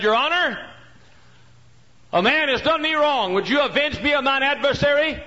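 high, loud begging plea; desperate widow begging loudly; pleading widow. Acted. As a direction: cry out to the judge for justice.

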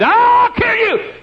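high, loud put-on voice of a threatening man; threatening Texan. Texan husband threatening the preacher, loud put-on voice. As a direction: bark the threat like an angry husband.

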